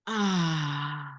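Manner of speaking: low, soft exhaled ah; soft ah sound. Shown as a demonstration. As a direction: breathe it out as a deep ah. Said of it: "let out".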